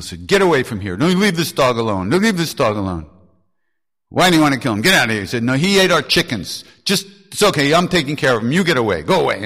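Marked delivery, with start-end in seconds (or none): 0.3-5.2 loud, high shouting; yelling at people. Retold or imitated. Dada yelling at the men chasing the dog, loud shout. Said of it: "he yelled at the people". 5.4-9.4 loud, high shouting; yelling at people. Retold or imitated. Dada yelling at the men chasing the dog, loud shout. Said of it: "he yelled at the people".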